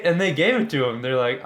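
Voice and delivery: usual speaking voice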